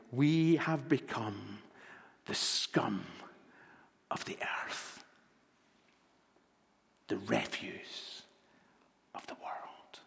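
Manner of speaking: slow, sinking into a whisper; slow reading that fades to a whisper; fading to a whisper. Acted. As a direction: let the words die away to a breath. Dramatic reading of the climax.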